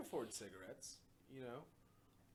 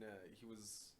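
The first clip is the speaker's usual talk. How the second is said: ordinary talk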